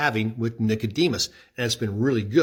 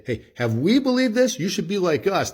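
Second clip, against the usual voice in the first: much higher, smug boasting tone; smug Pharisees; 7 semitones higher than usual. Retold or imitated. Pharisees boasting to the crowd, high and smug. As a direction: hold yourselves up as the model. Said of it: "they say".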